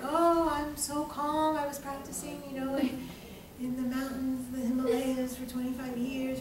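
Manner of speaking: softly spoken mock-zen voice; put-on calm. Man back from twenty years of meditation, mildly put-on calm. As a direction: drift through it softly, unruffled. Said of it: "he's all calm".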